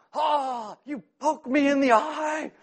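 high, slow hammed-up wail of hurt; mock pained whine. Pastor retelling his wife poking his eye, hammed-up high wail. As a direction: play up the pain like a big baby. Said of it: "And I'm like".

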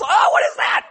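high, loud mock shriek of alarm; Sarah. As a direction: imitate a startled, grossed-out colleague. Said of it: "Sarah comes in yesterday ... She goes".

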